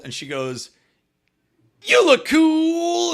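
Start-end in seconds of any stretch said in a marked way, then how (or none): 1.8-3.1 high, slow, loud cheer; girl cheering on her date, in a high slow loud cheer; enthusiastic reassurance. Retold or imitated. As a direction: beam it out with double thumbs up. Girl reassuring him with thumbs up.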